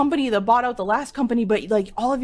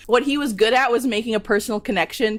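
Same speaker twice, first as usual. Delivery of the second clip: normal talking voice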